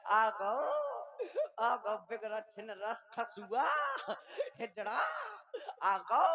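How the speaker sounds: wife greets the drunk husband with mock-sweet abuse; mock loving greeting. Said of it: "तो बड़े प्यार से कहे वो".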